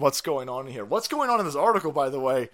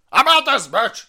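loud, higher exasperated yell; body quitting loudly; 5 semitones higher, 9 dB louder than usual. Acted. The body quitting on its owner, exasperated yell. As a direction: shout it like you're storming out. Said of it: "Your body's sitting there going".

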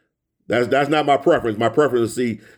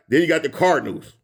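normal talking voice

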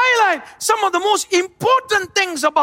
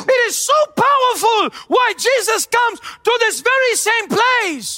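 emphatic, raised sermon delivery; forceful preaching; 4 semitones higher than usual. Real, not acted. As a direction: preach it out to the whole congregation.

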